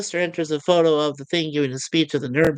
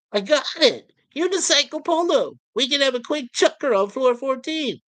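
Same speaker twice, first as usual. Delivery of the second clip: much higher, thrilled burst; excited Cat; 8 semitones higher than usual. Acted. Cat proposing unicycle polo to bored Lister, thrilled and higher. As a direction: pitch the idea like it's genius.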